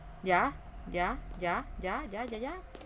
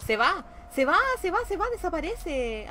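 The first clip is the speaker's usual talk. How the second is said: higher, drawn-out excited cry; 7 semitones higher, 5 dB louder than usual. Real, not acted. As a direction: cheer it away with rising excitement. Watching the thing disappear in game.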